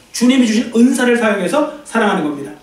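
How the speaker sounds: ordinary delivery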